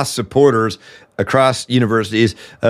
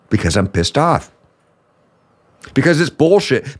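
pissed off, contained. Acted. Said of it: "you yell so much".

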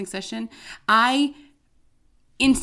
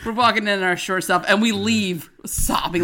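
normal talking voice

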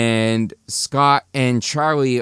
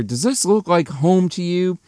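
retold yell; 6 semitones higher than usual. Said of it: "Scott yells at the reindeer".

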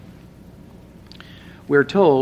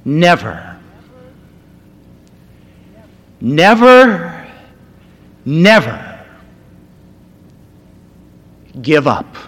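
soft building to a shout; 2 semitones higher, 7 dB louder than usual. Retold or imitated. Preacher retelling Churchill to the schoolboys, soft then roaring. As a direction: build from a hush to a full roar. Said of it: "at first softly".

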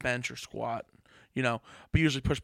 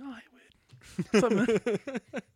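awkward slow greeting; 10 semitones higher, 5 dB louder, much slower than usual. Retold or imitated.

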